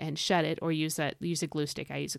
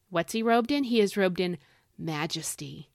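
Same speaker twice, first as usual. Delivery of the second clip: ordinary talk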